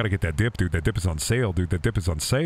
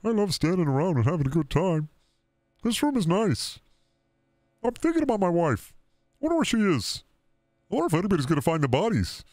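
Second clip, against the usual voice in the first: higher, dopey put-on voice for the game guy; a goofy higher voice for the game character musing to himself; character inner voice; 7 semitones higher than usual. Voicing the game character's thoughts.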